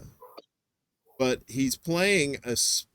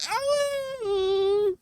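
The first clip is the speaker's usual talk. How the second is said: very high, drawn-out swoon; mock ecstasy. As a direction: squeal with overblown delight. Gushing over hearing the word.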